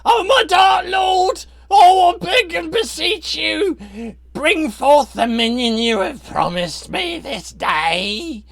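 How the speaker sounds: high, slow, shrieking plea; shrieking cultist. Acted. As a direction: shriek it out in terror to your dark lord. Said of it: "and his shriek is".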